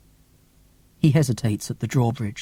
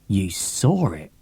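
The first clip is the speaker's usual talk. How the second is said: growl; 5 semitones lower, slower than usual. A narrator's reading. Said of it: "growls Griffin".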